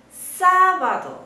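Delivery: "sábado" with "sá" stretched high; high sustained first syllable. Shown as a demonstration. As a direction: hold the "sá" of "sábado" high and loud, then drop. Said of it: "en español también hay este tono".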